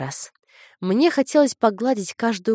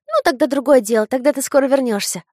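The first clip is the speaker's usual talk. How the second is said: высокий детский голос, со смешком; беспечная девочка; весёлый ребёнок; 7 semitones higher, 6 dB louder than usual. A narrator's reading. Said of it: "рассмеялась она". (весёлый детский голос)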